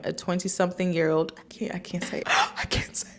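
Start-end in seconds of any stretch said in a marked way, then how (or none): 1.4-3.1 breathy secret whisper. Pretend. Whispering secret about age.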